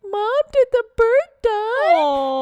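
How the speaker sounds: put-on high, slow little kid asking; child's voice. Mom imitating her youngest asking about the bird, high slow child voice. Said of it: "My youngest in the back was like".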